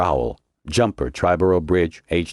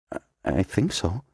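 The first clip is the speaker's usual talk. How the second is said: softer, hesitant and faltering; 3 semitones higher, 5 dB quieter than usual. A narrator's reading. Snow unsure before the commander, faltering. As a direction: stumble out an unsure yes. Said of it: "his voice faltering a little".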